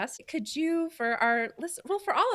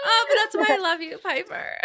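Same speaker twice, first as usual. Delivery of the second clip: high laughing warmth, much higher; laughing affection; 8 semitones higher than usual. Real, not acted. Host to her friend Piper, laughing with affection. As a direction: gush fondly through a laugh.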